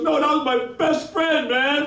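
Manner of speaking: high, emphatic fan voice; imitated excited fan. Fan explains about his best friend, excited. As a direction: correct him eagerly, pitched right up.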